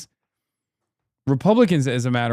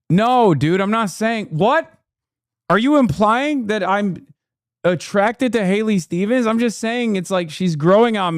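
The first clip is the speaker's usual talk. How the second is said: higher, defensive protest; 7 semitones higher than usual. Real, not acted. Host denies a crush to co-host, defensive. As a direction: bristle at the accusation.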